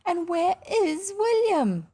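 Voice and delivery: high-pitched plaintive wail, stretching out at the end. A narrator's reading. Mother lamenting her missing son, wailing. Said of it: "wailed Missus Brown".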